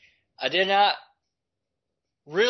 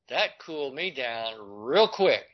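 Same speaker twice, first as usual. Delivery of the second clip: ordinary delivery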